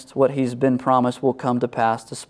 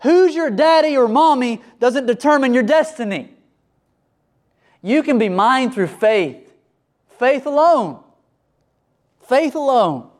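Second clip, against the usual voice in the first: God proclaiming; 9 semitones higher than usual. Retold or imitated. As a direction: cry it out with joy to the congregation. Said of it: "God is saying".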